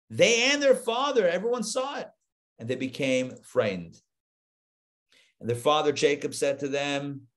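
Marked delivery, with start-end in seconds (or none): none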